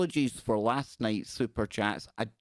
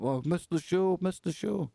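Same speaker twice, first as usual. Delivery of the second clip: lightly mimicked whining viewers, pitched up; mock complaint; 6 semitones higher than usual. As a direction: imitate viewers moaning, a bit higher. Imagines late viewers' complaint.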